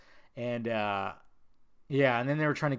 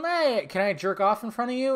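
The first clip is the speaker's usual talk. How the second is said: lightly mimicked, higher-pitched request; mock asking voice, pitched up; a hint of impersonation, raised pitch; Louis C K; 8 semitones higher, 4 dB louder than usual. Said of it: "I think when some girls let him".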